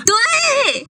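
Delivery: cracked, squeaky high note; voice cracking. Real, not acted. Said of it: "破音了".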